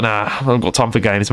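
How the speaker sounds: ordinary delivery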